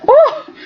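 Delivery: amused laugh rising to a squeak; high laughing ooh. Real, not acted. Vlogger laughing at forgotten characters, high amused ooh.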